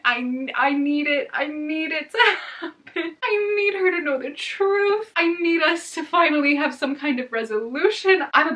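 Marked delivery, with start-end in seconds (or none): 0.0-5.1 desperate whine. Real, not acted. Desperate fangirling.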